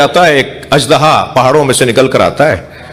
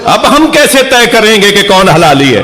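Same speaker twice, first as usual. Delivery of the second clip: raised, ringing majlis climax; preaching at full force; 7 semitones higher than usual. Acted. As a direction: lift the crowd to its peak.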